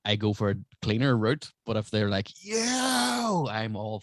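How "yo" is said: very high, long drawn-out whoop; hammed-up high holler; mock crowd reaction. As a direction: imitate a scandalised crowd, pitched way up. Imitates crowd reacting to dirty joke.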